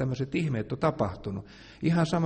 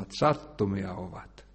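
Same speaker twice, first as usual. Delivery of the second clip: usual speaking voice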